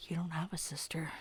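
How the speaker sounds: hushed mock echo; whispering. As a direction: whisper it back teasingly. Echoing friend's line whispered.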